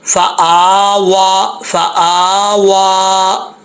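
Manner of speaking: very slow "फ़आवा", each long vowel stretched; drawn out vowels. Shown as a demonstration. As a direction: stretch the hamza and waw in "फ़आवा". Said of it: "एक अलिफ की मकदार हमज़ा को भी खींचेंगे". ("फ़आवा" को बहुत धीमा, खींचकर)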